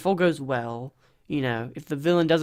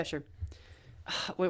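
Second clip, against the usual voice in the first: normal talking voice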